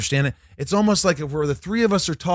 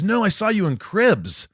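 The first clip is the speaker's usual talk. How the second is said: enthusiastic imitation; 7 semitones higher, 4 dB louder than usual. Retold star's enthusiastic reply.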